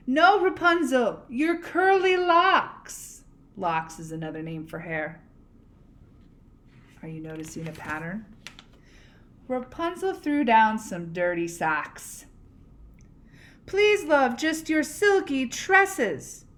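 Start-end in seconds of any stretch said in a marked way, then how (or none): 0.1-3.2 higher, louder, slow correction up the tower; exasperated call. Acted. Prince corrects her, calling up. 13.7-16.5 higher, louder, slowed plea; pleading call. Acted. Prince pleads upward.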